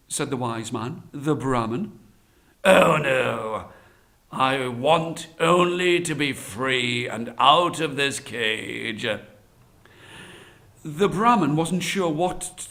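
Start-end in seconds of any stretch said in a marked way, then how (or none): 2.6-9.1 slow, drawn-out tiger voice; pleading tiger. Acted. Tiger asking only to be free, coaxing. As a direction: stretch the words out, starting loud.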